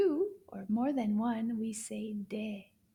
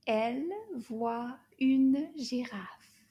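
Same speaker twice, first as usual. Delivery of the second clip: slow model line; 3 dB louder, slower than usual. Shown as a demonstration. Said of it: "let's say it all together".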